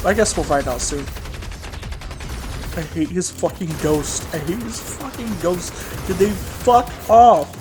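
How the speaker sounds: normal talking voice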